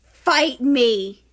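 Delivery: loud cocky shout; playful challenge. Acted. America shouts a cocky challenge at the ghost, loud. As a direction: dare them, loud.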